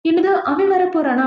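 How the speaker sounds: acted-out shocked question; retold shock. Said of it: "இவ shock ஆயிட்டு இருக்க".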